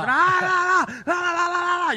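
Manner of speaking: put-on loud, high kid's tantrum screech la-la-la; mock tantrum wail. Retelling a child's store tantrum, mock high wailing. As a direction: wail it like a screaming kid in a store. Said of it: "Que eso no bajaba".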